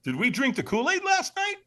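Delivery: rising, high disbelief; stunned by the sight; astonished. Retold or imitated. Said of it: "'Cause I'm saying".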